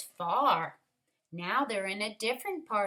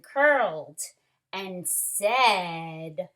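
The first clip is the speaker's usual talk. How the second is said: put-on lively read-aloud for children; story reading; 6 dB louder than usual. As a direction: read it like a picture book to the class. Story narration continues.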